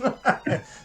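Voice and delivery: high burst of laughter; real laugh. Real, not acted. Comedy fan amused by his own anecdote, laughing. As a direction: crack up at your own story.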